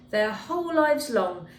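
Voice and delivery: plain reading voice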